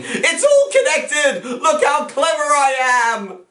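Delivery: very high, drawn-out boastful yell; Stephen Moffat's ego yelling. Acted. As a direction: crow like an inflated ego. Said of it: "Stephen Moffat's ego yelling".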